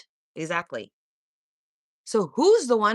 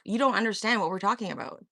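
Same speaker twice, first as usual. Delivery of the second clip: normal talking voice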